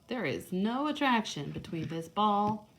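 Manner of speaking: usual speaking voice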